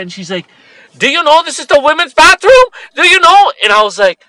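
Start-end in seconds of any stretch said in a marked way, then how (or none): 1.0-3.5 high, loud scolding demand; upset yelling. Retold or imitated. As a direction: call him out loudly and shrilly. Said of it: "she's so upset".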